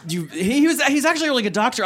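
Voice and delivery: high, fast, laughing; laughing aside. Real, not acted. Comic reassuring the audience, laughing. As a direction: blurt it out through laughter. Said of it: "laughing aside".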